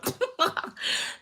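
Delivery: high breathy laugh through tears; laughing to stop the crying; real tearful laugh; teary laugh. Real, not acted. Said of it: "I'm gonna cry".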